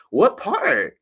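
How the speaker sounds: high, loud curious question; mimicking a lady. Canadian lady asking where in Canada, high mock voice. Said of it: "she was like".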